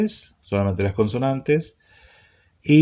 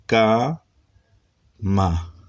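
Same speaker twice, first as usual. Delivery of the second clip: syllable by syllable. Shown as a demonstration. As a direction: sound out ca and ma one at a time. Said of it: "primero consonante vocal". (ca, ma sílaba por sílaba, con pausa)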